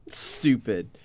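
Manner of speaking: ordinary delivery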